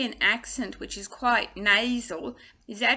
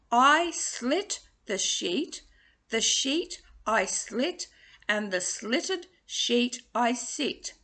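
very slow, each word set apart; slow tongue twister; slower than usual. Shown as a demonstration. Coach modelling the sheet twister slowly. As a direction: lay the words out slowly. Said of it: "so practice that".

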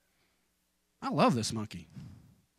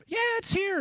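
light, much higher mock mom voice; imitating his mom. Son retelling his mom's phone answer, higher mock mom voice. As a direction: squeak it out like his mother. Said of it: "She had it".

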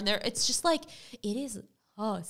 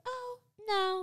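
high, soft mock-sweet voice; imitating a clerk softly denying it; imitated clerk; 13 semitones higher, 4 dB quieter than usual. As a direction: put on a very high, gentle "oh, no". Retold clerk reassuring about photo.

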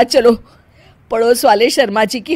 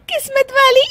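high squeal of a question collapsing into laughter; bursting into laughter. Acted. Bride echoing the aunt's compliment, laughing uncontrollably. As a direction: repeat the words and crack up. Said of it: "हंस क्यों रही हो?".

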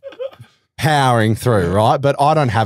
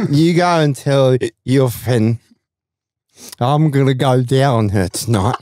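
put-on drunken come-on; slower than usual. I'm so drunk.

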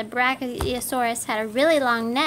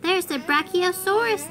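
shouted; 6 semitones higher than usual. Acted. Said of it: "they shouted".

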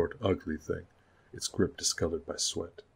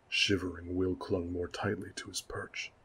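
plain reading voice

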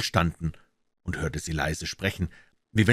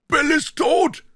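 high, loud cry of news; bellowed shout; 6 dB louder, slower than usual. A narrator's reading. Man in house to gang outside, bellowed. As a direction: yell it out from inside the house. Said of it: "dann brüllte eine Stimme aus dem Hause".

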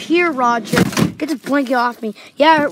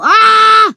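loud sudden scream of alarm; startled scream; 6 semitones higher, 8 dB louder than usual. Pretend. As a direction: shriek as the lights die. Lights go out in play.

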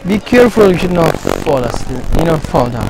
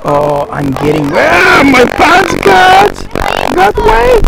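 high, loud startled exclamations; excited shrieking; 13 semitones higher, 7 dB louder than usual. Real, not acted. Slipping into deep water.

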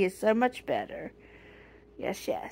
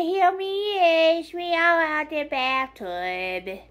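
pet voice; 8 semitones higher, 4 dB louder, slower than usual. Pretend. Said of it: "He said".

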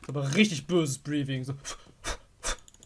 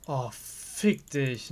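resigned curse; 2 semitones lower, 6 dB quieter, much slower than usual. Real, not acted. After dying.

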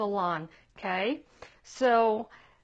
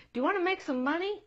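put-on higher, brighter calling out to the kid; eager parent call; 8 semitones higher, much faster than usual. A parent calling kid number two over, eager. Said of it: "you're like".

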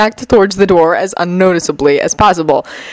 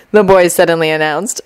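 ordinary delivery

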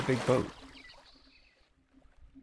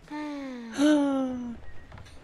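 drawn-out high gasp; lingering squeal of a gasp; long high gasp. Real, not acted. Reacts to the game.

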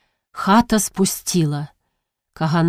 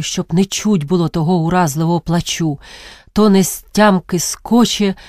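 ordinary delivery, nothing marked

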